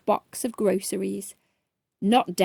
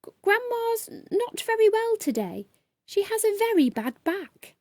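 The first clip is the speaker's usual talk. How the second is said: high, nervous stammering voice; young trainee explaining to the angry customer; timid little girl; 10 semitones higher than usual. A narrator's reading. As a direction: stammer like a frightened child. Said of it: "she squeaked".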